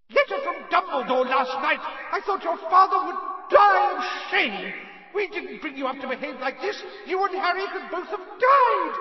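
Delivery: shrieking, very high mother's tirade; furious yelling. A narrator's reading. Mother's howler berates son for taking the car, furious yelling. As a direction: bellow a scolding at full force, screeching. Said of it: "Missus Weasley's yells".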